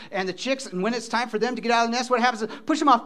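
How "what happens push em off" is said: ordinary talk, nothing marked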